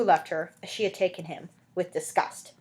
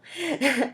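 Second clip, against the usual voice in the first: quick amused chuckle; a genuine little laugh at the story; reader's real laugh; 7 semitones higher than usual. Real, not acted. Reader laughs at the travelling dentist, short chuckle.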